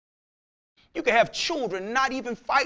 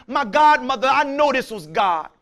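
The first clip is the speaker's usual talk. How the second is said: ordinary delivery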